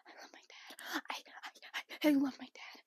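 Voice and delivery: breathy unvoiced whisper. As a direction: whisper it, dazed and stammering. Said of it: "She's like".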